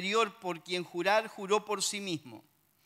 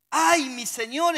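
higher, louder, slow cry of alarm; frightened exclamation; 7 semitones higher, 9 dB louder, slower than usual. A narrator's reading. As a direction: cry out to his master in fear. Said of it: "exclamó". (el criado exclama asustado a Eliseo)

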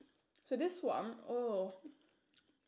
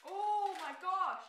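surprised; 5 semitones higher, much slower than usual. Real, not acted. Opening pineapple crisps, amazed.

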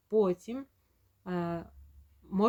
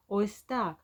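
usual speaking voice

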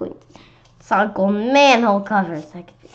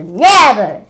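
loud refusal; 10 dB louder than usual. Acted. Old lady refusing.

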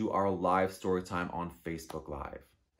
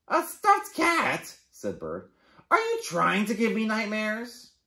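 high, loud mock horror; appalled bird voice; 11 semitones higher, 6 dB louder than usual. Bird horrified by Rabbit's stuffed kitty. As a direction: act shocked at the idea.

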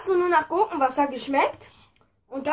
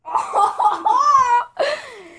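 burst of laughter; 8 semitones higher, 4 dB louder than usual. Real, not acted. Laughing at the drink mix.

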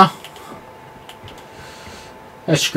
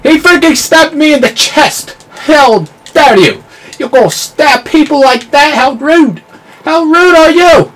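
high, loud offended yelling; real scandalized shout; indignant outrage; 11 semitones higher, 4 dB louder than usual. Real, not acted. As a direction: scold the player who stabbed you. Stabbed by enemy, indignant.

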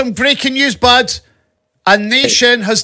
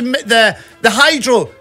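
ordinary talk, nothing marked

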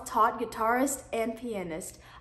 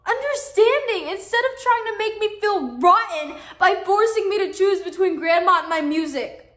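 loud, high furious outburst; angry shouting; 5 semitones higher, 6 dB louder than usual. Acted. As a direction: yell it at your mom. Teen yells at her mother.

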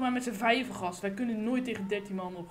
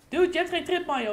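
louder and higher, calling the teammate out; loud scolding; 6 semitones higher, 8 dB louder than usual. Real, not acted. Gamer scolding a teammate over the mic, loud rebuke. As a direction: bark the correction at him.

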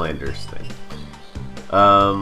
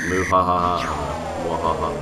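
evil laugh. Pretend. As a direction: ham up a villain laugh. Mock villain laugh.

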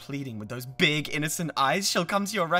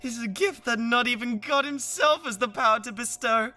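sneering and raised, exalting her while belittling him; contemptuous rant; 7 semitones higher, 6 dB louder than usual. Acted. Rival sneers at the man who wants her, contemptuous rant. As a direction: lecture him with disdain.

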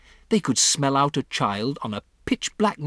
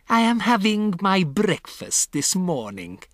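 put-on high, accented declamation; raised, theatrical witch voice; witch crying out; 9 semitones higher, 3 dB louder than usual. A narrator's reading. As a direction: proclaim it as a haughty witch. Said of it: "cried the Grand High Witch".

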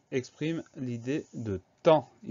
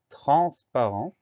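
TRANSPARENT slow and louder, syllables drawn out; slow dictation; 3 semitones higher, 6 dB louder, slower than usual. Shown as a demonstration. Teacher announcing the third word, slow dictation. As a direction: stretch "transparent" out clearly for spelling.

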